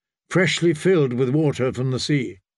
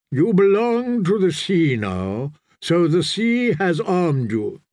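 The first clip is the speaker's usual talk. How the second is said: slow, higher-pitched ritual declaration; solemn priest; 4 semitones higher, slower than usual. Acted. Priest arms the new drowned man, solemn and slow. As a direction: pronounce it slowly as a blessing.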